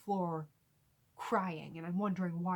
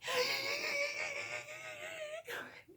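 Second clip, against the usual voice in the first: long high-pitched wheezing gasp; imitated breathless squealing gasp. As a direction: gasp wildly, unable to get words out. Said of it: "finally get enough air".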